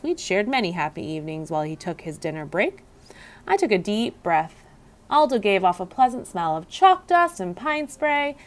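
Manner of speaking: plain reading voice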